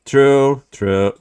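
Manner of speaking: ordinary talk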